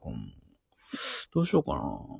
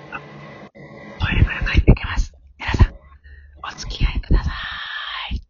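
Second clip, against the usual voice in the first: urgent hushed whisper. Real, not acted. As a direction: whisper quickly before he's back. Partner returning, keep it secret.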